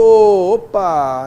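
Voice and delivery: ordinary talk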